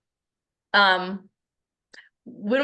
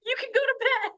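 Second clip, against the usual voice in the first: high mock dismissive voice; 11 semitones higher than usual. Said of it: "And I was like".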